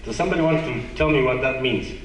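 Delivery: normal talking voice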